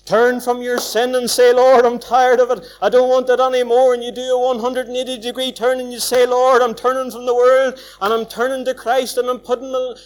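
loud, driving gospel appeal; fervent preaching. Real, not acted. Preacher pleading with sinners to turn to Christ, loud and fervent. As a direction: preach it hard, pressing the crowd to turn.

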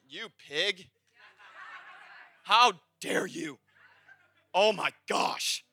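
mock outraged congregation. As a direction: scold the pig in fake horror. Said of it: "Y'all would be like".

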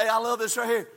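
ordinary delivery

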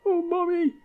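put-on a child whines to Mommy after being scolded, plaintive; crying child. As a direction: whimper like a toddler scolded in the store. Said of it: "And then you started to cry".

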